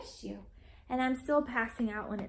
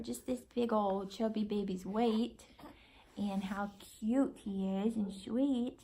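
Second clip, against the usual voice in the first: ordinary talk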